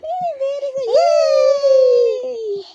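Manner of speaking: high, loud delighted cheer; excited cheer. Real, not acted. Cheering toddler's correct answers.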